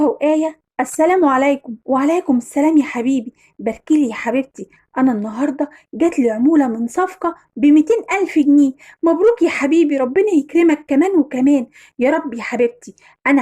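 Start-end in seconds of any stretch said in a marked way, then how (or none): none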